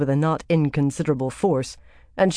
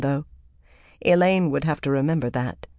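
plain reading voice

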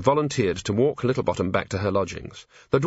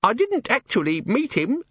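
very high, squeaky correction; pocket imp; 14 semitones higher than usual. A narrator's reading. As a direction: protest like a tiny creature. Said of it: "said the imp".

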